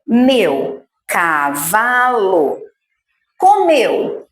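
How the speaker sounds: meu cavalo drawn out very slowly; slow dictation. Shown as a demonstration. Teacher slowly separating meu cavalo for the child. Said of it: "se eu tenho mais que duas".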